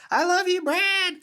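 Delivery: a woman standing in the audience yelling her love; imitated yell. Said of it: "she stood up and was yelling".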